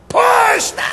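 high screamed imitation of a wife in labor; screaming. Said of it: "My wife was screaming".

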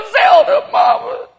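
full-force high screams, hammed up; very high, loud imitated screaming. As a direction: scream out names like someone waking in a panic.

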